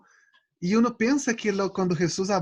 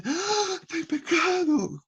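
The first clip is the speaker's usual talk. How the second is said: much higher mock gasp of alarm; hammed-up shock at having sinned; 11 semitones higher, slower than usual. Preacher mimicking a believer shocked at a glance, a mock alarmed cry. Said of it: "Y dice".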